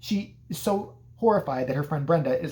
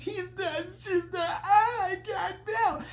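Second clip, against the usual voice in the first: very high sobbing falsetto; mock hysteria; 14 semitones higher, slower than usual. Alice telling the old lady of deaths, mock hysterical sobbing. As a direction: blubber in hammed-up panic.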